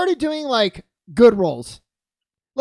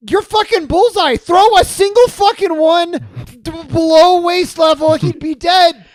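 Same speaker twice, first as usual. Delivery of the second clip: loud, high comic outrage; worked-up mock scolding; exasperated rant; 7 semitones higher, 9 dB louder than usual. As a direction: yell at the movie character in disbelief. Exasperated mock rant at film villain.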